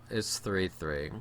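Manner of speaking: ordinary talk, nothing marked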